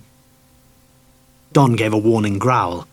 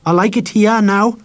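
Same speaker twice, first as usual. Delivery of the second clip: higher, louder stubborn declaration; defiant boy; 10 semitones higher, 5 dB louder than usual. A narrator's reading. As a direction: stand his ground, chin up. Harry defends his home defiantly.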